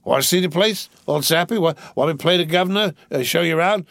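put-on slurred, giggly drunk rich boy. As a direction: play a rowdy drunk young gentleman. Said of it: "I'm drunk".

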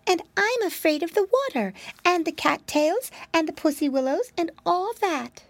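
put-on high, fretful little girl voice; a tiny frightened mouse wailing; timid mousy girl. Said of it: "said the little creature".

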